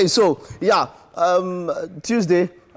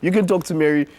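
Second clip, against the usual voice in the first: ordinary talk, nothing marked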